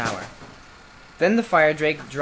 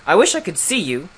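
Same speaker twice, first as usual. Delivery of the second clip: raised voice of a grumpy beast; grunting beast; 4 semitones higher, 5 dB louder than usual. A narrator's reading. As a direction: grumble it loudly as a beast. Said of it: "grunted the beast".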